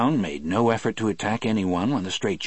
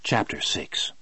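whispered; 3 semitones lower than usual. A narrator's reading. Chapter heading.

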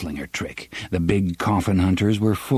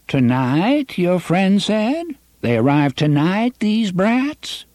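put-on high, slow quavering old man; quavery voice; 10 semitones higher, slower than usual. A narrator's reading. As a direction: reassure in a shaky old voice. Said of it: "his quavery voice".

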